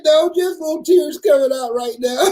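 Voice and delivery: high, whiny admitting tears. Retold or imitated. A grown man admitting tears to the dentist assistant, high and whiny. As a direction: squeak it out sheepishly. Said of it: "I said".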